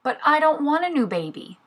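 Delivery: high-pitched little tiger protest; reluctant answer. A narrator's reading. Little tiger answers Mommy's baby news, high-pitched protest. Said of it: "Little Tiger answered".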